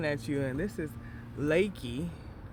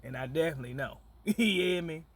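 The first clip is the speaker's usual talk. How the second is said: normal talking voice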